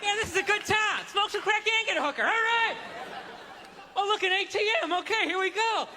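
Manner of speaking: high, hammed-up giddy tourist voice; giddy Vegas tourist. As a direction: play the easily tempted guy, high and eager. Tourist voiced giving in to temptation.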